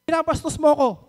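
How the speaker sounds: imitating a loud, high angry accusation. Woman passing the dining table accuses the speaker, loud angry imitation. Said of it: "sabi niya".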